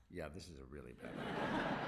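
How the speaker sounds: lightly voiced coach, chuckling through it; laughing as she quotes. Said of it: "And she goes".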